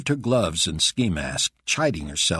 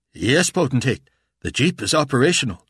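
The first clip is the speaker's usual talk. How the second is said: higher, louder, brisk mechanic; 7 semitones higher, 6 dB louder than usual. A narrator's reading. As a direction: answer the boss briskly. Mechanic answers Carpathia.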